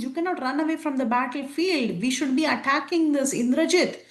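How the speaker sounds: urgent rally. Retold or imitated. Hanuman rallies fleeing Vanaras.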